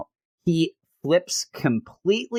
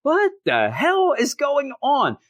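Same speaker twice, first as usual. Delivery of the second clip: usual speaking voice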